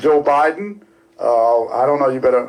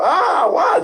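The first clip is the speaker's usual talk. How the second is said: high-pitched imitation of mom screaming; mock scream. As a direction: act out mom's amazed outburst, pitched way up. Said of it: "just screaming".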